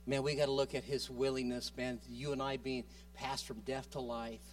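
ordinary talk, nothing marked

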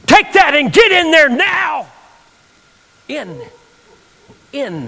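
high, loud barked command; a guard under duress, high and loud; urgent order. Retold or imitated. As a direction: order him through, fast and forceful. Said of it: "under great passion and duress".

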